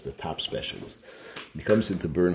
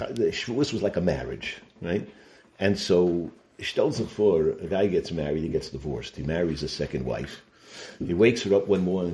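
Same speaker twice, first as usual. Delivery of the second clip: usual speaking voice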